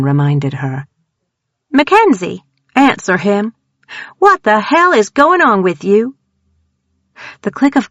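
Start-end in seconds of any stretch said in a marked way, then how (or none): 1.7-6.2 raised, urgent demand. A narrator's reading. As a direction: press her hard for an answer. Tanner demands, raised pitch.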